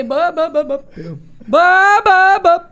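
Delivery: loud, high "bam" punches acting out kicks; imitated kicks. Retelling how he kicked the door in his sleep, loud bangs. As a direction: slam each bam out like a kick.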